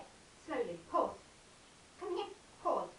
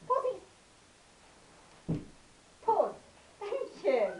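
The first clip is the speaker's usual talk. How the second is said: high squeaky dog praise; squeaky praising a dog; 8 semitones higher, 6 dB louder, much slower than usual. Real, not acted.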